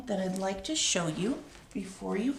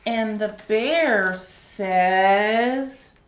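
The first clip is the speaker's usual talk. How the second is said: drawn-out suspense line; slow buildup; 7 dB louder, much slower than usual. A narrator's reading. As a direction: stretch each word slowly. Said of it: "storyteller slows for suspense".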